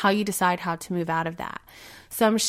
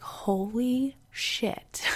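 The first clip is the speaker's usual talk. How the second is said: slow, stunned whisper; awed whisper; 3 semitones higher, 5 dB quieter, much slower than usual. Retold or imitated. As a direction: breathe it out in slow amazement. Her reaction on first hearing the story.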